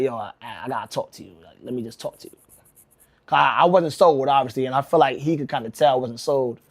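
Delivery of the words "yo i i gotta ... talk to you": low, soft retold plea; lightly acted, hushed persuasion; hushed coaxing. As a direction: imitate a caller quietly talking someone round. Retold caller coaxing him on phone.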